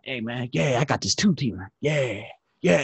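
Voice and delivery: low, muttered stranger voice; low-voiced imitation of a rambling stranger; odd stranger.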